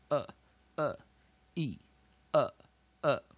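"呃、 呃、 e、 呃、 呃" as short, separate vowel sounds; weak vowel sounds. Shown as a demonstration. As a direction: sound out each weak vowel briefly, with a gap after it. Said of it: "通常发音是". (短促地逐个示范弱母音“呃”)

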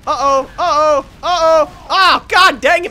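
loud, high cries of dismay; high, loud gamer panic; alarmed shouting. Real, not acted. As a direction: yell out as it goes wrong. Attacked and dying in game.

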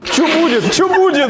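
high mock alarm; mock panic. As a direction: fake panicked question. Mock panic, much higher.

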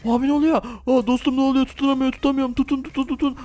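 high, startled playful panic; panicky. Pretend. Riders losing hold on a spinning ride, panicky. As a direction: cry out while scrambling for a grip.

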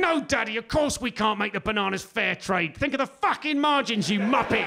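mock tantrum from a tiny executive; screaming toddler CEO. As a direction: rant like a spoilt little boss. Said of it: "demented, screaming four-year-old CEO".

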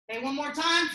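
usual speaking voice

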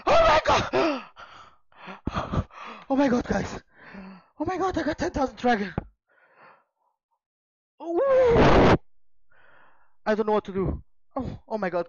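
screaming high and loud in disbelief; ecstatic screaming. Real, not acted. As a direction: shriek with real joy at the pull. Pulls rare card, screams in joy.

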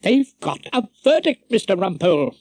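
formal high-pitched court announcement; the usher intones the news; intoning usher. A narrator's reading. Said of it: "the usher intoned".

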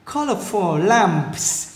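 very slow Colorful lamps, COL stressed; first-syllable stress. Shown as a demonstration. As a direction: punch COL in Colorful, finish lamps on a clear ps. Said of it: "nhấn âm đầu các bạn nha". (Colorful lamps, nhấn âm đầu, thật chậm)

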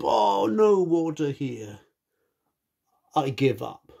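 giving up. Acted. Elephant gives up tired.

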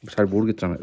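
usual speaking voice

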